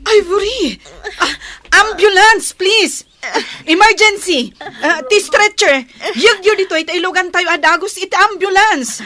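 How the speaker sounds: loud, high urgent calls for help; urgent shouting. Acted. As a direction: yell across the hall for the ambulance. Nurse calling for emergency help. (napigsa nga ikkis ti nurse)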